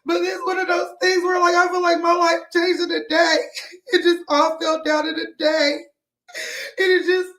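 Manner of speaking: real crying. Real, not acted. Streamer telling viewers life fell apart in a day, crying. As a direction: choke up while pushing the words out. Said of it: "I'm sorry, I'm crying on live".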